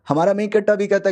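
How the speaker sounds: ordinary delivery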